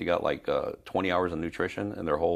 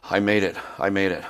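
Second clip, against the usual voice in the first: a touch of winded, excited relief, a bit faster; gasping out a proud little victory; breathless triumph; 4 semitones higher, 6 dB louder than usual. Retold or imitated. Man reaching gym after two hours.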